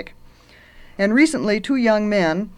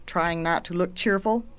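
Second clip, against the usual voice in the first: ordinary delivery, nothing marked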